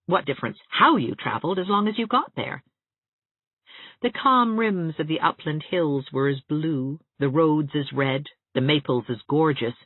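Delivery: usual speaking voice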